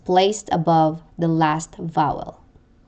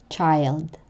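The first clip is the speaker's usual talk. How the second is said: usual speaking voice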